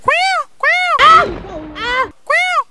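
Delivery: very high, loud, slow clucking; hen cluck. Shown as a demonstration. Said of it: "anabaki kuwa analiaje".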